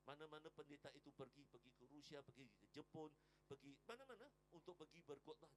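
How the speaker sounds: usual speaking voice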